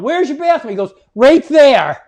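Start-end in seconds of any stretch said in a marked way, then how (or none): 0.0-0.7 high, raised-voice question; re-enacted holler, pitched up; yelling on the run. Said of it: "as I'm yelling". 1.1-2.0 loud, raised reply; imitated shout, higher and emphatic; shouted directions. Bartender answers the yelled question.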